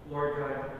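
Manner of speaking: ordinary talk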